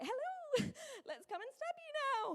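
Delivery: high, bright sing-song nurse greeting; chirpy nurse. Retold or imitated. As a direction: cheerfully announce the jab like good news. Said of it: "you're way too chirpy about it".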